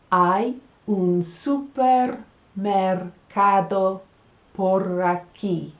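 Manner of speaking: slow, careful answer; slow model answer. Shown as a demonstration. Teacher modelling the answer slowly for learners. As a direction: spell out each word slowly. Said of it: "how do you say that".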